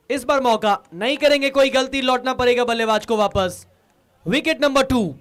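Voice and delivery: loud call of the wicket; excited cricket commentary. Real, not acted. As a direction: call the dismissal with a rising shout. Wicket falls, commentator calls it. (जोशीली wicket call)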